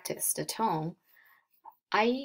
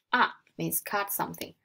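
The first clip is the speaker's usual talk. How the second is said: ordinary talk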